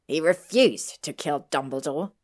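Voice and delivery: loud, snappish correction; snapped. A narrator's reading. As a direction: snap back with force. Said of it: "Harry snapped".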